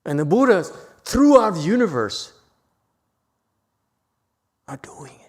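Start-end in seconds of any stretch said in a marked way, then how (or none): 4.7-5.2 hushed, breathy whisper; awed whisper. Real, not acted. Teacher awed by Buddhas across the universe, whispering. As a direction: drop to a whisper of awe.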